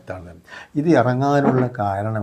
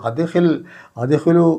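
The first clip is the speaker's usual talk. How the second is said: high, loud divine command; commanding call; 6 semitones higher, 6 dB louder than usual. Retold or imitated. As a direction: call it out like a decree. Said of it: "അന്ന് പറയും". (ഉച്ചത്തിലുള്ള ആജ്ഞ)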